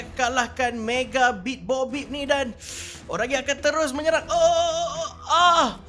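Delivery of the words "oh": ordinary talk, nothing marked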